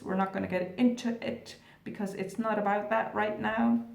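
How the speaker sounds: ordinary delivery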